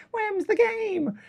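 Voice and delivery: very high, slow squeak; squeaky character voice. Acted. Streamer naming a game in the high silly voice. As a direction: say it in a silly, tiny put-on voice.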